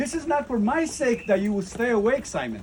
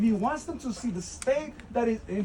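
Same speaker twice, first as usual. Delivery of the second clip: ordinary delivery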